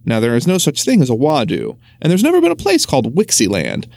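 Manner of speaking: ordinary delivery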